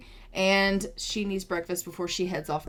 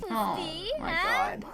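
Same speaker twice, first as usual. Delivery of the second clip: excited squeal; 9 semitones higher, 3 dB quieter than usual. Real, not acted. Squealing at sims' romance.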